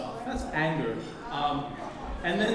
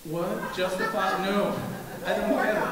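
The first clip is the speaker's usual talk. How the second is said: ordinary talk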